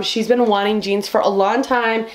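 ordinary talk

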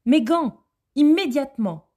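high, loud calling out for Marianne. A narrator's reading. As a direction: call out the name from outside, impatient. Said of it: "disait la voix".

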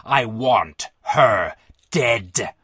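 very low, extremely drawn-out demand; choked with emotion. A narrator's reading. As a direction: force each word out slowly and deep. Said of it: "Emotion choked off Brazel's voice".

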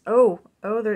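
sudden loud excited oh oh. Real, not acted. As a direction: blurt out in delight.

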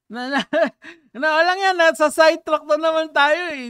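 loud, high-pitched complaint after laughing; playful exasperation. Real, not acted. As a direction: protest loudly at being sidetracked by silly friends. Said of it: "Dinidistract niyo ako sa mga kalokohan niyo".

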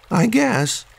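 small high sad voice; lightly voiced mopey raccoon. A narrator's reading. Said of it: "said Raccoon sadly".

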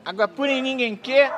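loud teasing vendor chant; singsong beach vendor call. Acted. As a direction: sing it back to mock him. Rival repeating mocking chant.

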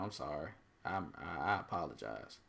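normal talking voice

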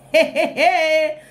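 laughing out a happy hey, hey; real laugh through the words. Real, not acted. Laughing at own joke.

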